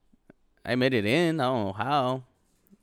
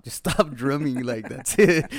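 raised-voice retold complaint; insist, getting louder to the end; exasperated demand; 3 semitones higher, 8 dB louder, slower than usual. Paranoid partygoer telling the drummer to quit, exasperated.

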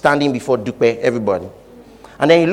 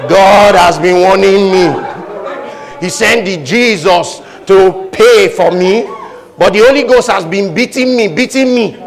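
mock testimony; 4 semitones higher, 5 dB louder, slower than usual.

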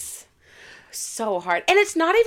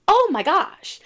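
higher-pitched retold gasp of delight; amazed realisation; 9 semitones higher, slower than usual. Actor recalling her character finally clicking, amazed. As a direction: exclaim it as a sudden revelation.

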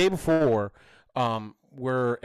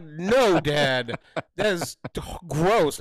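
high, loud imitated disgust; grossed-out daughter; 4 semitones higher, 5 dB louder, much slower than usual. Daughter refuses dad's kissing dare, mock disgust. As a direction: play a kid protesting to dad.

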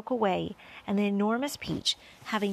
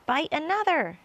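brisk higher-pitched command; giving an order; 7 semitones higher, 3 dB louder than usual. A narrator's reading. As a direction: order the next move. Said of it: "James ordered".

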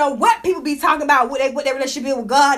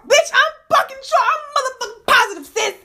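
higher and heated, insisting she's sure; emphatic comeback; 7 semitones higher than usual. Real, not acted. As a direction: snap back, dead certain. Fires back at Gayle's doubt.

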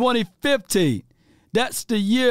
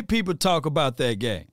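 usual speaking voice

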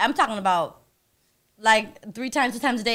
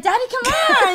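high-pitched mock begging; coaxing plea; 8 semitones higher than usual. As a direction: coax a reluctant lover, playfully hammed-up. Acts out coaxing her partner.

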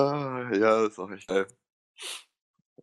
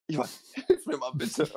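high, far-off pleading voice of a lost guest; helpless guest; 10 semitones higher than usual. Retold or imitated. Club guest pleading at the cash desk, high and helpless. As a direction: beg for help in a small high voice.

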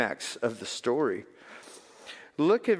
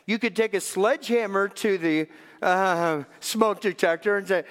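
ordinary delivery

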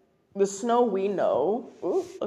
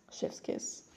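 whispered; 15 dB quieter than usual. Real, not acted. Praising the scene.

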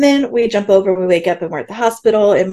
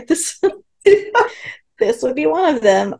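laughing; 7 semitones higher than usual. Retold or imitated. Laughing through a retold quote.

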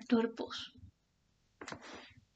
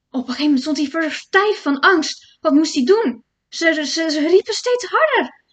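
rising louder and higher with dread; building suspense; 5 semitones higher, 9 dB louder than usual. A narrator's reading. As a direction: build the fear to a climax. Spanning bouwt op. (steeds luider en hoger van angst)

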